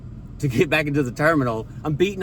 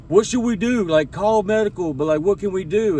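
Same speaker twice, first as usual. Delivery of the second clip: higher, helpless questions; hammed-up clueless crew voice; flustered flight attendant; 5 semitones higher than usual. Flight attendants unsure what to do.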